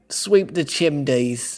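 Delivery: slow, laughing chimney-sweep British; badly faked British accent, drawn out. Host fumbles British for friends, bad British accent. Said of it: "I can't do British".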